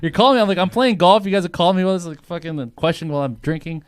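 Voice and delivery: high loud exasperated retelling; indignant rant. Retold or imitated. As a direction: rant at legal like you're on the golf course. Retold rant at company legal.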